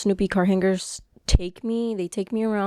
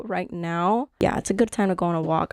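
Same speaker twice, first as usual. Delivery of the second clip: ordinary talk, nothing marked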